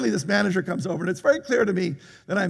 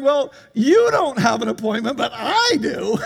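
laughing retort; 7 semitones higher, 4 dB louder than usual. Retold or imitated.

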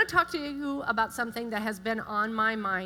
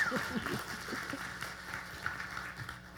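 breathy nervous laugh; 10 dB quieter than usual. Real, not acted. Nervous laugh taking the mic.

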